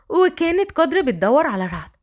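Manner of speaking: ordinary delivery, nothing marked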